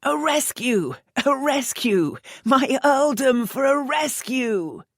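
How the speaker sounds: high, loud, hammed-up cry; laughing mock shout. Earl outbidding her in jest, laughing shout. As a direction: overplay the desperate plea, laughing. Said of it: "laughter in his last cries".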